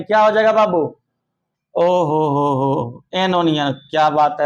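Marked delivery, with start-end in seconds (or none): 1.7-3.0 slow, drawn-out delighted "oho ho ho". Acted. Teacher hamming up delight at a pupil's answer, slow drawn-out oho. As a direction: stretch it out like you can't believe how clever they are.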